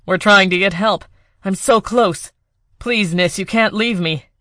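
loud, desperate begging; desperate plea. Acted. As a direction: hold her here with your voice. Desperate lover pleading.

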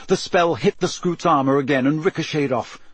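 usual speaking voice

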